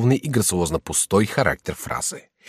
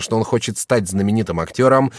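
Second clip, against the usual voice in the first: ordinary delivery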